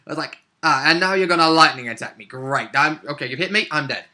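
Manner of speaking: ordinary talk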